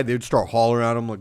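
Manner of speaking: ordinary talk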